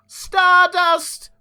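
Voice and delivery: high, loud, drawn-out announcer voice; dramatic title announcement. Acted. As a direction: boom it out like an old radio serial opener. Narrator announces the comic title.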